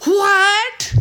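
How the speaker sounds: a high, loud, rising What; the preacher booming the Greek objection; emphatic shout. Shown as a demonstration. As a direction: fling it out as a total rejection. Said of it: "emphatically say it in the Greek text".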